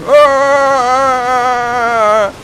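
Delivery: long, high, loud mock wail; slow-motion cry. Fan mocking companions running to Frodo, slow-motion cry. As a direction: drag out a melodramatic running scream. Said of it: "running towards him in slow motion".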